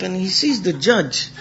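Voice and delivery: ordinary delivery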